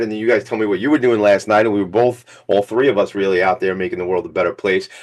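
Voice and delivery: ordinary talk, nothing marked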